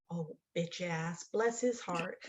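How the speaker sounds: low, slow, very soft aside; muttered retold curse. Mother-in-law cut off in the parking lot, low muttered curse. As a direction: imitate sweet cursing under the breath.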